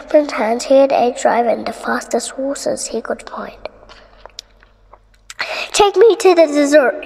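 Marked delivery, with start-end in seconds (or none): 5.7-7.0 high, loud commanding order. A narrator's reading. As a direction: bark the order at the driver. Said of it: "he ordered".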